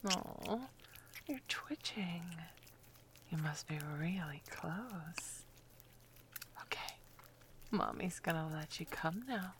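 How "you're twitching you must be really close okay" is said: soft teasing whisper; fading hushed whisper. Acted. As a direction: whisper right by the ear.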